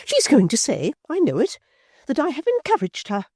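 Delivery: high, loud indignant matron; a wounded lady turning cold and grand; high, loud offended dowager; Missus Chick, outraged. A narrator's reading. Appeal to the furniture.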